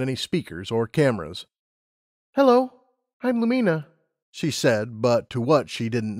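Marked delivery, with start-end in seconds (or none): 2.3-3.9 put-on high, eager young mare; Lumina's voice. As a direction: voice her up high, keen and bright-eyed. Lumina greets unseen AI.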